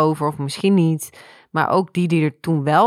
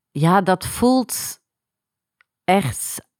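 slow, voice about to break; fighting tears, words stretched; holding back tears; 3 semitones higher, much slower than usual. Real, not acted. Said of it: "zonder dat ik weer ga huilen".

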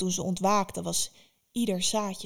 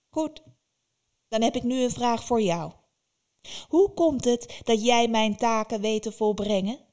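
louder, probing witch voice; demanding witch; 3 semitones higher, 5 dB louder than usual. Acted. Baba Yaga demands how Vasilisa does the tasks, loud and probing. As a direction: press her for the answer.

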